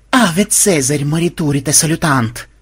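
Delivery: ordinary delivery, nothing marked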